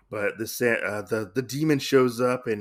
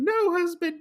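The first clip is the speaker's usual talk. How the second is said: wife's cry in a much higher falsetto; frantic wife. Retold or imitated. Wife calls out to her husband from the background, high falsetto. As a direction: squeak it out like a panicked wife.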